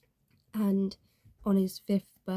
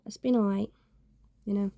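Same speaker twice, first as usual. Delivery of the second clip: normal talking voice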